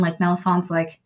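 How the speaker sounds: ordinary talk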